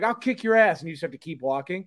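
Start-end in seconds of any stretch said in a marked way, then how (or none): 0.0-0.8 higher, louder mock threat. Retelling a stranger threatening to fight, louder mock threat. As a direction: bark a fake street threat. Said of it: "they're like".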